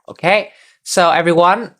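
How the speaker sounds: ordinary delivery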